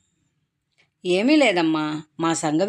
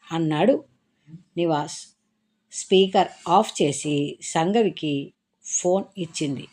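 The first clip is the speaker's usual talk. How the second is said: ordinary delivery, nothing marked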